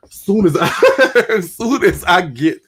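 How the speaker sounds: real laugh breaking up the words. Real, not acted. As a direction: try to get it out through laughter. Laughs while telling her thought.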